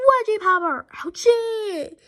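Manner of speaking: loud, high comic-book yelp; action exclaim. Acted. Hero winning the fight, loud comic yelp. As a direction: shout it like a punch panel.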